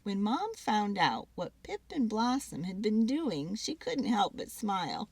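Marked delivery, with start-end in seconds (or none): none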